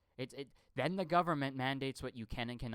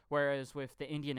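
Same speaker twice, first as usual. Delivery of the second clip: usual speaking voice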